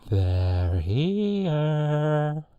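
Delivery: loud drawn-out call, sliding high; movie quote impersonation. Acted. Host repeating the film line into the microphone, drawn-out high call. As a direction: hold the last word long on a high note. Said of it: "I'll do it again".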